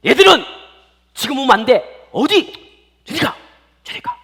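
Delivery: high, loud rebuff, easing off at the end; disciples shooing children. Acted. Disciples block children from Jesus, loud scolding. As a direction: drive them off in sharp bursts. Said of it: "하면서 예수님 제자들이 아이를 막았었어요".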